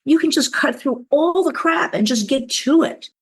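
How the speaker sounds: building enthusiasm, climbing in pitch; excited realization. Retold or imitated. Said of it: "I went".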